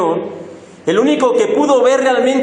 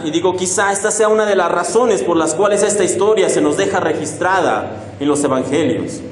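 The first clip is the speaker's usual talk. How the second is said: normal talking voice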